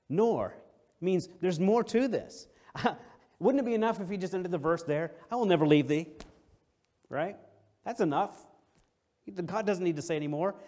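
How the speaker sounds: loud, high preacher's cry; preaching. Acted. Preacher to congregation, loud high preaching. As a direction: press the point hard on the congregation.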